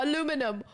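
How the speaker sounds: Aluminum as four syllables, imitating Americans; American pronunciation. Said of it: "And you go".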